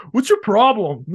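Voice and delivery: high, loud mock indignation; mock his clueless defensiveness; loud high imitation of a huffy boyfriend; indignant boyfriend. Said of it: "he goes".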